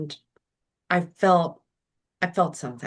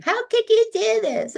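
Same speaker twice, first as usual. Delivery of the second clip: much higher, shrieking mock accusation; mock outrage; 4 dB louder than usual. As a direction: play the offended wife at full pitch. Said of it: "anything was an affront to me".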